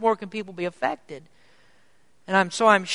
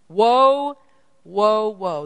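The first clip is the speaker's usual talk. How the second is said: echoed angel cry; 3 semitones higher, 5 dB louder, much slower than usual. Acted. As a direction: cry it out again for emphasis. Preacher repeats the angel's cry.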